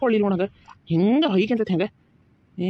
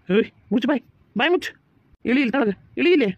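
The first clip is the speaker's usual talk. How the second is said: louder, higher yelling orders; angry shout; 6 semitones higher, 5 dB louder, faster than usual. Acted. Ordering the crying child down, angry loud shout. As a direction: order the wailing child down sharply.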